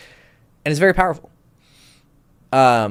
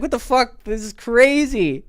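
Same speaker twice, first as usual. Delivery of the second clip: higher, slowed amazed friend; blown away; 13 semitones higher, 4 dB louder, slower than usual. Retold or imitated. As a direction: stretch out crazy in awe. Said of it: "He's like".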